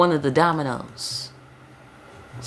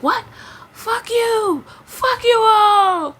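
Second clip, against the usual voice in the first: much higher, louder, slow yelled outburst; mock furious shout; 5 dB louder, much slower than usual. Reader imagines Jesus losing it at everyone, mock furious shout. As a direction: scream it like a snapping Jesus. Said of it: "Jesus couldn't be like".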